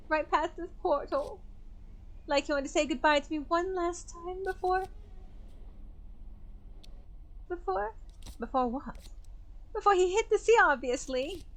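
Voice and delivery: high, strained voice of a distressed widow; grieving widow Clarissa. Acted.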